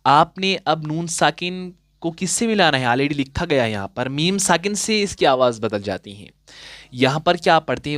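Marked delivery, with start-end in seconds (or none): none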